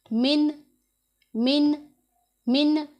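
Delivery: मिन with a full, plain नून and no nasal hum; clear nun. Shown as a demonstration. Teacher drilling the clear nun for her students. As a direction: say मिन crisply, not म्यां. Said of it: "म्यां नहीं करना".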